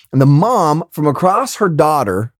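ordinary delivery

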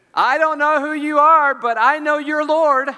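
loud, high proclamation; awestruck declaration. Acted. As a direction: cry it out as a stunning realization. Acting out Paul's stunned recognition.

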